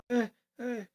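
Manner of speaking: high little creature cry; imitated Cubone whimper. Cubone standing there alone, whimpering.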